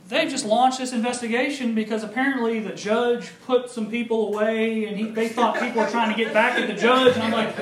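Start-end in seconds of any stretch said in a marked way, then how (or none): none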